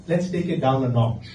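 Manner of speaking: normal talking voice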